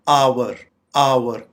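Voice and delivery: "आवर" said AA-wur in two syllables; correct pronunciation. Shown as a demonstration. Teacher modelling the correct word, "आवर" as AA-wur. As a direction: give "आवर" a clear w: AA-wur. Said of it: "इसकी correct pronunciation है".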